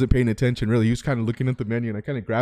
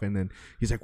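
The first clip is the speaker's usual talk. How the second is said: usual speaking voice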